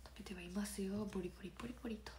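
unvoiced secretive whisper of 食べてはいますよ; hushed, conspiratorial whisper. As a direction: breathe it like a secret confession. Repeats admission secretly.

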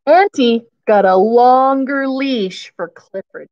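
plain reading voice

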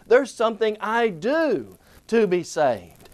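ordinary talk, nothing marked

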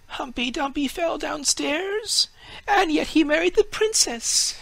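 a naive tree marvelling aloud; tree's thoughts. A narrator's reading. Fir tree marvels at the Humpty Dumpty story, high and awed. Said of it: "thought the fir tree".

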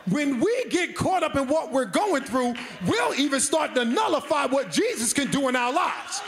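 repeated "It doesn't make a difference" lines hammered one after another; building sermon refrain. Acted. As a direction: drive the refrain home.

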